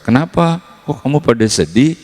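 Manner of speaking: Jesus asking. Retold or imitated. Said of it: "Yesus nanya gitu".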